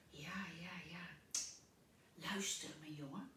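hushed, unvoiced whisper; whispering old man. Old Mr. Pen whispers urgently to Pluk. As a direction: breathe it to the boy secretly.

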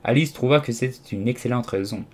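plain reading voice

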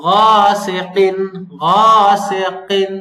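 গাসিকিন, heavy qaf, drawn out. Shown as a demonstration. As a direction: say গাসিকিন slowly with thick qaf. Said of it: "বড় কফ করে একটু ভারী করতে হবে".

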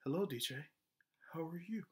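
hushed, gentle father greeting; tender murmured hello from dad; soft dad voice. Retold or imitated. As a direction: drop to a near whisper like a doting dad. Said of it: "Then he's like".

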